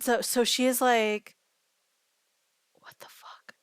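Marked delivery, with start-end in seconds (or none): 2.7-3.5 breathy stunned whisper; shocked whisper. Retold or imitated. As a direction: gasp it out under your breath in disbelief. Retold shocked reaction to wheelchair.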